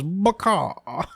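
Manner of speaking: a high, squawked "Baka"; chicken squawk. Acted. Chicken devil Bucky squawks "Baka" as he is killed, high and cartoonish. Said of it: "Bucky goes".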